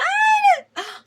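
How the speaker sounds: high, loud shocked gasp; sharp gasp. Real, not acted. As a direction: gasp in real surprise. Reacting to the card.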